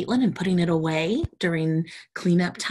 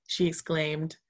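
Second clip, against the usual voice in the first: usual speaking voice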